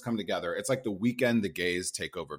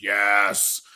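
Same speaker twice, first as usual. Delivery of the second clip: emphatic yes; 7 dB louder than usual. Retold or imitated. Acted instant yes to moving.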